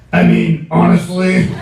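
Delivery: mock deep raspy voice, half-laughing; imitating friend's deep raspy voice. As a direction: do your buddy's gruff voice, laughing through it. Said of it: "his deep, raspy voice".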